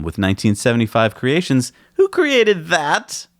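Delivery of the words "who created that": high playful voice. Acted. Admiring playful question.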